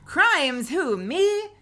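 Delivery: high, loud cheeky greeting; mock innocence. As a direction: play innocent.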